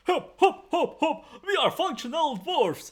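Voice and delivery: high-pitched chanting dwarf voice; drunken dwarves. Acted. Drunk dwarves dancing arm in arm, high mock chant. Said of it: "they're just hanging each other over their shoulders and like".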